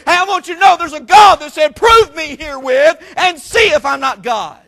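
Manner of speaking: loud, high preacher's testimony; preaching. Acted. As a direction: preach it with rising fire to the church. Preaching climax to congregation.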